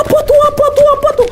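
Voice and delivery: high, frantic repeated cry; a friend flees down the trail, yelling in panic; panicked yelling. Retold or imitated. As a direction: yell it over and over while running. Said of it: "udah teriak-teriak".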